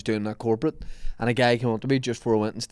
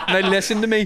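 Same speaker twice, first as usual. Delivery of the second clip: mock commander; 7 semitones higher than usual.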